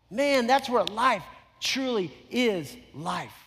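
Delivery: rising preaching. Acted.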